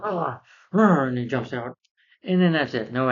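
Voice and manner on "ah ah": mock pained groans. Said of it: "He's like".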